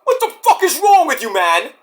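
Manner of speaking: high, shouted mock confrontation; imitating angry Tony. Tony confronting Oba over the insult, high and angry. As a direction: confront him like an angry cousin. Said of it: "Tony D comes in and is like".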